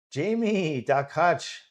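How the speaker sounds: loud, high drawn-out shout-out; calling out. Real, not acted. Commentator greeting viewers lurking and learning, calling out loudly. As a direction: hail them by name, high and loud.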